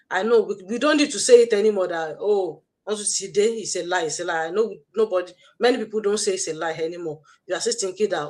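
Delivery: ordinary delivery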